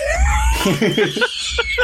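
high shouted burst of laughter. Real, not acted. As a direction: howl with laughter. Laughs at own punchline.

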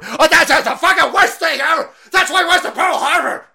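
high, loud sarcastic shriek; mock outrage. Mocking the media's panic, high loud fake horror.

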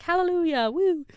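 loud, high hallelujah whoop; hammed-up church cheer; mock hallelujah. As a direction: whoop it up like a revival crowd.

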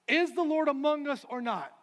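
high, loud mock challenge to God; doubting Israelites. Pastor voicing the Israelites' doubt, a loud challenge. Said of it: "This is what they were saying to God".

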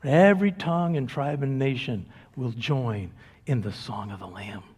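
gently recited hymn words. Acted. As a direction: savour each word of the old song. Quotes worship song lyrics slowly.

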